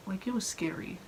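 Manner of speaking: scary whisper; playful spooky voice. Acted. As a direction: whisper it like a campfire tale.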